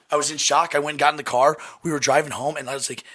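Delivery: normal talking voice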